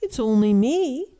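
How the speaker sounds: middle goat. A narrator's reading. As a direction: pipe up as the middle billy goat. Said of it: "said the goat".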